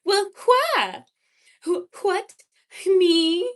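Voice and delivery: high mid-Atlantic with exaggerated breathy WH; hammed-up mid-Atlantic, overdoing the WH; mid-Atlantic accent. Shown as a demonstration. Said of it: "mid-Atlantic, uh, girl who just adds, like, the W H sound".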